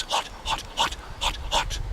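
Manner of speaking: breathy whispered repetition; whispered hot. A narrator's reading. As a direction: whisper the heat out. Describing the heat.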